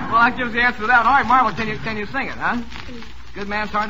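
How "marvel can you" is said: normal talking voice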